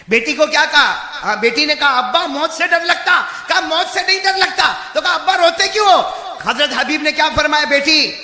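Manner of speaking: loud, high fire-and-brimstone sermon; shouted preacher's exhortation; full-force preaching. Acted. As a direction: thunder the warning at the whole crowd.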